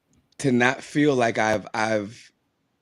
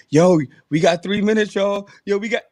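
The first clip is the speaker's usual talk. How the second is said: excited call-out; 8 semitones higher, 5 dB louder than usual. Retold or imitated. Retold calling out at midnight.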